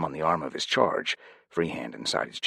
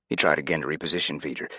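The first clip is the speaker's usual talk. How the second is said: plain reading voice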